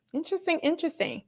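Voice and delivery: mock intrigue. Real, not acted. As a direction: say "interesting" twice like a sly conspiracy theorist. Musing on Chris Evans news.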